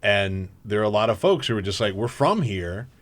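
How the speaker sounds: ordinary talk, nothing marked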